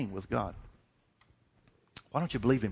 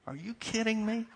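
higher, mock disbelief; incredulous; 9 semitones higher, 5 dB quieter than usual. Preacher scoffing at a church discount, high incredulous. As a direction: scoff as if it's absurd.

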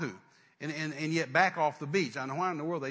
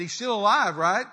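insistent question; 4 semitones higher, 9 dB louder than usual. Retold or imitated. Demands news of dying uncle.

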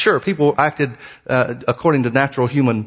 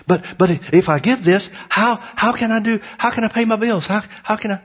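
higher stammering worried voice; mimicking an anxious believer, higher and stammering; mock panic; 6 semitones higher than usual. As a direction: imitate a nervous giver who fears the bills. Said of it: "people get all buggy".

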